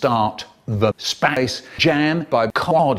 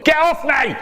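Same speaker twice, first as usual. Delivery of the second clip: shrill squeal of get off; high shout; 13 semitones higher than usual. Acted. Being sat on.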